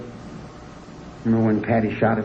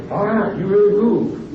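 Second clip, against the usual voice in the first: mock admiring; 9 semitones higher, slower than usual. Quoting admiring people.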